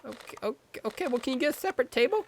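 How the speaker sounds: high, rushed, hesitant plea; timid boyfriend. Pretend. Boyfriend begs movie dad for space, high nervous plea. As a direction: squeak it out softly, then hurry. Boyfriend pleads for separate table.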